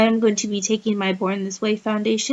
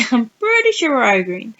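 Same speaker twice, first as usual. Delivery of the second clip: laughing while talking; 5 dB louder than usual. Real, not acted. Laughing at her own texting.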